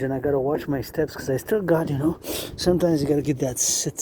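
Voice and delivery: usual speaking voice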